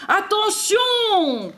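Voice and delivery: high, drawn-out storybook yell; warning cry. A narrator's reading. Santa warning as the sleigh races over snow, crying out. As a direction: stretch it into an alarmed call. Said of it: "crie le Père Noël".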